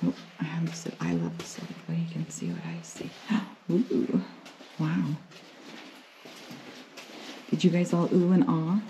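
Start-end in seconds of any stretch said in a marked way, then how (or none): none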